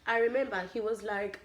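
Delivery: ordinary talk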